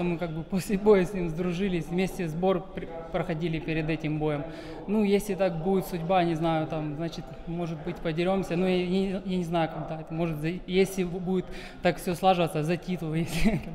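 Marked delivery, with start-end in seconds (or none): none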